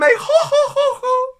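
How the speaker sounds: imitating a high, loud ojou-sama laugh; hammed-up haughty anime "ho ho" laugh; anime lady laugh. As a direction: mimic an anime rich girl's cackle. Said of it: "She does the little anime".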